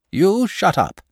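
stern, high rebuke; severe. A narrator's reading. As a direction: dragon scolding the boy, severe. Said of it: "said the dragon severely".

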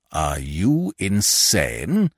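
slow, low incredulous growl. A narrator's reading. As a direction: growl it out slowly in disbelief. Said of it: "growled Mister Keeble".

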